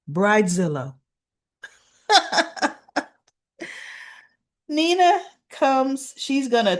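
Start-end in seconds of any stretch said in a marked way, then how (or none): 2.1-2.7 giggle. Real, not acted. Laughs at her own joke.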